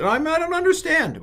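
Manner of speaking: high whiny mock student voice; confused student. Teacher mocking a confused student, high and whiny. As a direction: squeak it out like a baffled pupil. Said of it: "don't get".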